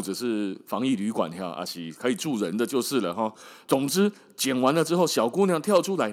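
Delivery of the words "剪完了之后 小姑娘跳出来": plain reading voice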